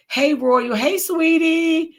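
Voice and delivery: high, stretched hello; high, drawn-out greeting to a viewer; cheery greeting. Real, not acted. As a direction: sing out a sweet hello.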